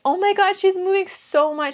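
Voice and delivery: put-on high giggly gush about the baby; amused at the kicking baby; laughing. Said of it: "And I start laughing".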